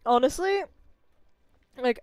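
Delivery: ordinary delivery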